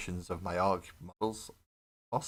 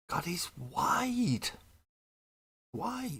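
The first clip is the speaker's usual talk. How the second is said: much higher, very slow, stretched-out "wide"; amazed at width; 11 semitones higher, much slower than usual. Real, not acted. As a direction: drag the word out in mock awe. Marvelling at the model's width.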